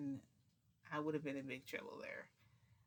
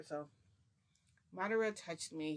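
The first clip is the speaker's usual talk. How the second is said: ordinary delivery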